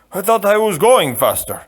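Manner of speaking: a big man apologising meekly; the giant apologising to his boss, meekly. Acted. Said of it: "the Turk answered meekly".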